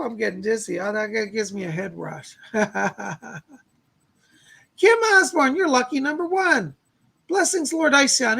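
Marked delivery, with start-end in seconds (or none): 4.8-8.4 high, loud shout-out to the viewer; excited announcement. Acted. Picking a viewer for a reading, loud cheerful call-out. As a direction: call out her name like a winner.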